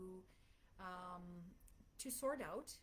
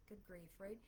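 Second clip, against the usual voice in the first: ordinary delivery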